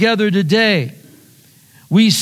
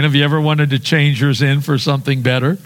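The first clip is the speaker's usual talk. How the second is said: usual speaking voice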